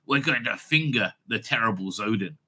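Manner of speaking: low, understated announcement; the Doctor. Acted. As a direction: drop low and say it matter-of-factly. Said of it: "if the Doctor turned around and says".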